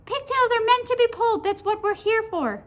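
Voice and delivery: high, loud squeaky creature; squeaky, emphatic talking pigtail; little pigtail voice. A narrator's reading. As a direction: declare it in a tiny high pigtail voice. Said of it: "said the pigtail".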